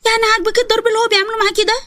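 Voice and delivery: put-on a worried child confiding a plan to her mother; young daughter. Daughter urging her mother to get help, high childlike voice.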